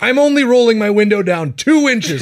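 high, loud sketch-character protest; defiant driver. Retold or imitated. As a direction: imitate a stubborn driver refusing a cop.

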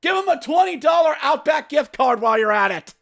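high, sarcastic shout at the refs; sarcastic yelling. Acted. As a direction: yell mock praise at the referees. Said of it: "I am yelling".

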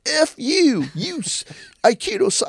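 high, squeezed mock rant; angry commenter. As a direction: imitate a hater's comment in a high voice. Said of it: "I read some of the comments".

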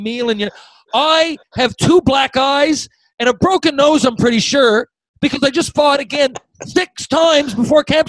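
high-pitched, worked-up tirade at teammates; indignant rant. Retold or imitated. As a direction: build from protest to loud, high outrage. Acted angry outburst at teammates.

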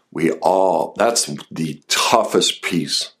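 usual speaking voice

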